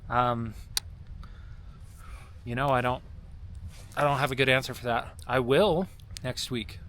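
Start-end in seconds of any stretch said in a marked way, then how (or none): none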